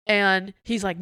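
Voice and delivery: ordinary talk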